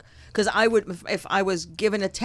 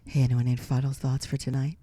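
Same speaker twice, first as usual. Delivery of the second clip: low, soft seductive voice; sexy voice; 7 semitones lower, 4 dB quieter than usual. Pretend. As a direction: purr it out, low and sultry. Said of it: "I'll do my sexy voice".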